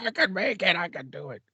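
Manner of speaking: high, strained, straining through the pain; imitating the hurt guy from Predator; pushing himself to carry on, high and strained; Predator log guy. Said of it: "That's how I was doing".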